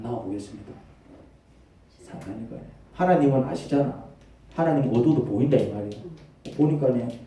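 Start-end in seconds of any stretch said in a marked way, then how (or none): none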